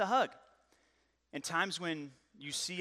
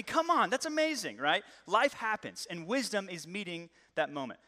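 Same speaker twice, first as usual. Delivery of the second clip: ordinary talk, nothing marked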